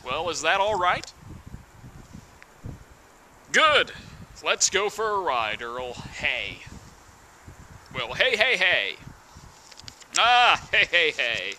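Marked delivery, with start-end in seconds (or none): none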